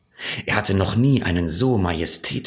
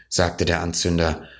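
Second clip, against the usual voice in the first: ordinary delivery, nothing marked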